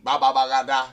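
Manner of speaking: loud babbled ba ba ba ga da; baby babble. Shown as a demonstration. Teacher demonstrates baby-like talk without vowels, loud babble. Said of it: "That's very, you know, baby".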